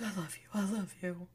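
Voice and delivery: soft, hushed imitation; whispered confession. Recapper imitating the man to his friend, hushed. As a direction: half-whisper it, imitating his tender words. Retelling a man's emotional confession.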